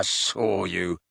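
low sneering contempt. A narrator's reading. Gaunt to Ogden, sneering. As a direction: sneer it quietly. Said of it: "sneered Gaunt".

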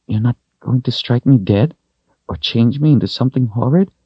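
put-on high, worried child's question; wary little girl. A narrator's reading. Girl asking the wizard if he'll harm her, wary. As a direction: ask it high and fearful.

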